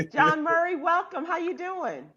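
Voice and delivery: high, loud greeting; bright welcome. Real, not acted. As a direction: welcome him in warmly and big.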